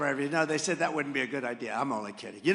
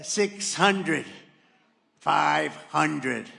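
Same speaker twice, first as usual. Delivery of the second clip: louder, even automated altitude callout; computer voice; 7 dB louder, much slower than usual. Retold or imitated. Pilot's cockpit warning reading altitude, in a computer voice. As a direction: read out numbers like the cockpit's computer. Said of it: "It's an incredible voice, a computer voice".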